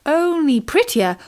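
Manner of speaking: ordinary delivery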